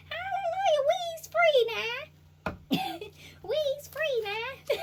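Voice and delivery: very high, loud, slow celebration; hammed-up hallelujah; joyful ancestors. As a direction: shout praise like freed spirits.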